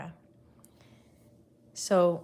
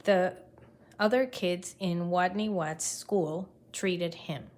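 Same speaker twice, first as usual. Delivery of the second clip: ordinary delivery, nothing marked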